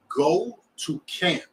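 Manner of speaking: each word slow and final; slow firm command. Retold or imitated. The Lord answering Ezekiel, slow and firm. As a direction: lay down the order slowly. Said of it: "He said".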